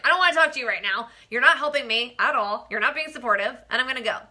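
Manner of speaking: loud, re-enacted phone quarrel; angry retort. Wife about to hang up on husband, angry retort. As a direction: fire it off loudly, acting out being fed up.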